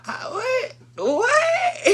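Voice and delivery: very high-pitched mock disbelief; shrieky, laughing "what?"; incredulous squeal. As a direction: squeal it as a stunned question. Acted disbelief at being told.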